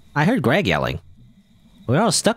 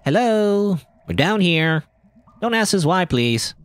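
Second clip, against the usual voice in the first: calling out; 10 semitones higher, slower than usual. Acted. Calling up out of pit.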